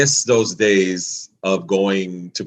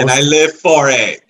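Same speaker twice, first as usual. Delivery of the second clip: excited; 6 semitones higher, 7 dB louder than usual. Real, not acted. Gushing fan defends song.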